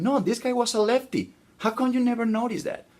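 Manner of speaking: very high-pitched, disbelieving correction; incredulous friend. Acted. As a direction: correct your pal, pitch shooting up in disbelief.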